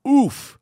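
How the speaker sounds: high, loud imitated oof of disappointment; mock groan. Comedian imitating Gary Goldman's loud high disappointed oof. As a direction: throw out a pained oof in his voice. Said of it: "he looks at me and he goes".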